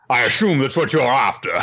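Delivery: high, loud, put-on smug voice; mock Andrew. Andrew taunting Phil, high loud smug put-on voice. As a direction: play it smug and hammy.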